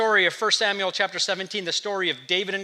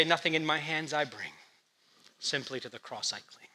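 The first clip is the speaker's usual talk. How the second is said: much softer, a reverent hush; hushed humble hymn line; 4 semitones lower, 8 dB quieter than usual. Pretend. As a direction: murmur it like a prayer at the cross. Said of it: "We're gonna say".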